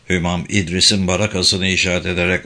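ordinary delivery

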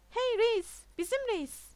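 loud, high rallying call. A narrator's reading. Pupil hails his departing teacher, calling out. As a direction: hail the teacher across the room. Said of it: "diye seslendi Todd".

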